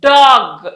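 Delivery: డాగ్ drawn out, ending in a firm hard "g"; డాగ్ with a clearly released final "g"; final G sound. Shown as a demonstration. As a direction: land hard on the closing g of డాగ్. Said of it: "sound గానే పలుకుతాం".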